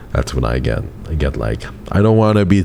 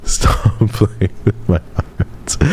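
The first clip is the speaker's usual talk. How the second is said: breathy laugh through the words, slow; 5 semitones higher, much slower than usual. Real, not acted. Laughing tease to chat.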